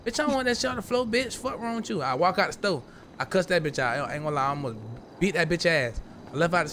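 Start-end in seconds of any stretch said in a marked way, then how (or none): 0.0-2.0 higher, fast, held-back mock tirade; cussing out the clerk over the floor; retold cussing. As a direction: rattle off the insults. Cussing out store woman.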